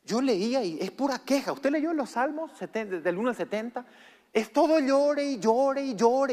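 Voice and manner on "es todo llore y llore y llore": slow, louder, higher mock whine; mocking complaint. Real, not acted. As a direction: make fun of the endless weeping. Burla de las quejas. (burla quejumbrosa)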